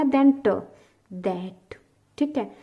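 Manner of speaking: ordinary talk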